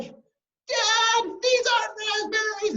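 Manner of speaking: very high, loud tantrum cry; acted childish fury; angry little girl. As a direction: wail at Dad in outraged protest. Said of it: "she stomped off".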